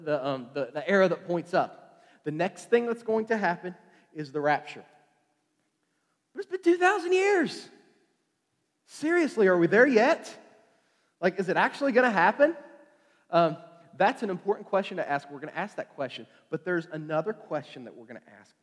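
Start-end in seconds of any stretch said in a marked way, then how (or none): 6.3-12.6 put-on higher, pleading kid-in-the-backseat voice; impatient. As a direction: whine it like a kid on a long drive. Preacher voicing impatient believers.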